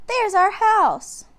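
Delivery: put-on girl spotting her home; excited. A narrator's reading. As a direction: point it out in wonder. Annie points excitedly.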